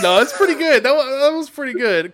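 delighted, still cracking up; excited. Real, not acted. Laughing excited praise.

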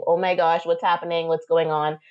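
usual speaking voice

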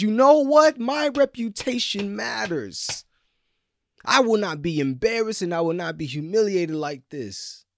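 high, loud wounded pride; a hint of offended dignity; indignant. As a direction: refuse the shame out loud. Preacher voices imagined proud Jesus.

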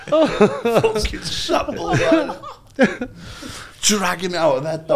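high, drawn-out words through laughter; helpless laughter. Real, not acted. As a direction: barely get it out for laughing. Still laughing at own story.

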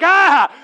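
high loud yelp of alarm; a parent's startled cry, high and loud; panicked shriek. Retold or imitated. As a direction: let out a sharp frightened ah. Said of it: "And I'm like".

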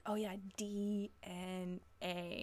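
slow realization. Retold or imitated. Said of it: "it took me forever to be like".

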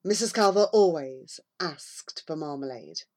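ordinary delivery, nothing marked

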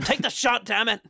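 high-pitched exasperated command; mock impatient order. McDowell ordering the shot, mock exasperated. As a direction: order it in high, hammed-up frustration.

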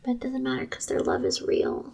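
whispered, soft; hushed. Real, not acted. Co-host whispering about the couple's real love. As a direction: say it low like a tender secret.

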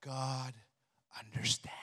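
soft tempting whisper in the ear; whisper into your ear. Acted. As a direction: breathe it like a tempter. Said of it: "the enemy will whisper into your ear".

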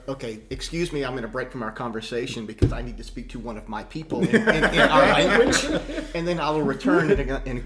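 laughing, hammed-up polite speech, loud on "in our language"; exaggerated courtly excuse, half-laughing; mock formal announcement. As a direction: play a host stepping away to speak his own tongue. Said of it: "it's like".

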